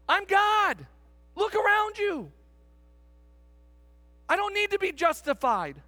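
high and loud, God speaking firmly to Moses; preaching at full force. Acted. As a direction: preach it with blunt divine authority.

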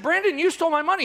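fast, high pretend accusation; mock accusation. Acted quarrel, pastor accuses Brandon.

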